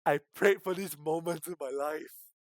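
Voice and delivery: loud, high thrilled cry; excited outburst. Real, not acted. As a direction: burst out with glee at the drama. Streamer reacts excitedly to drama.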